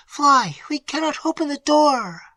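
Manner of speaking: high, urgent plea; cried out. A narrator's reading. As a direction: urge her to flee. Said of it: "cried Emily".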